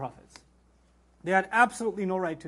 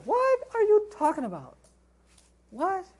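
much higher, incredulous "what are you talking about"; incredulous outburst; 11 semitones higher than usual. Real, not acted. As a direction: scoff in disbelief at the idea. Mocking the idea evidence alone stops sin.

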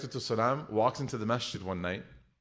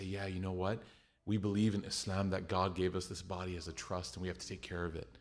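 much softer, lower, calm; gentle explaining; 5 semitones lower, 7 dB quieter than usual. Retold or imitated. Dietitian mom to a patient, soft and calm. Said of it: "she would just walk in and say".

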